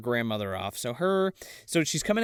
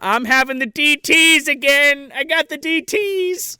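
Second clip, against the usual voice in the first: high, loud, slow wail; drawn-out hammed-up distress; mock panic; 10 semitones higher, 6 dB louder, slower than usual. Grandma thinks she is hallucinating, high slow mock-panicked cry.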